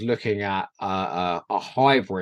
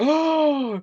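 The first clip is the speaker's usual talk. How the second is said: high, loud mock-shocked gasp; hammed-up reality-show gasp; imitated dramatic gasp; 4 dB louder than usual. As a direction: gasp a drawn-out "oh" as if at a plot twist. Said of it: "they sort of go".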